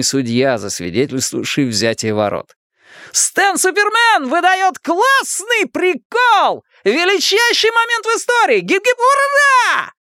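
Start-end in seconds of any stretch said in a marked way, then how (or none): 3.1-9.9 very high, drawn-out announcer holler; squealing, stretched-out celebration; exultant shout. A narrator's reading. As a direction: proclaim it like a stadium commentator. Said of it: "кричал Ричи".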